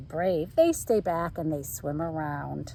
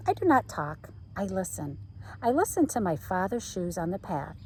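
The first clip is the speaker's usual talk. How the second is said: usual speaking voice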